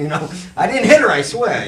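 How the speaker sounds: laughing it off loudly; chuckling through the words. Real, not acted. Laughing as she jokes to the hosts.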